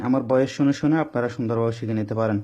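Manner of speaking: ordinary delivery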